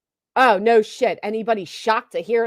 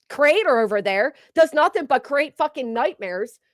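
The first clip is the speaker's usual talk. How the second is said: ordinary delivery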